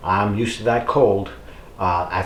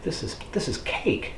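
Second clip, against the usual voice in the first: high, soft, breathless whisper; breathy whisper; 8 semitones higher, 10 dB quieter than usual. Retold or imitated. Runner recalling his easy sprint, whispered high and airy. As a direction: gasp it out like it was nothing. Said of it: "and I was like".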